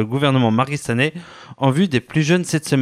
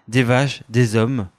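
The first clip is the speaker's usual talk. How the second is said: ordinary talk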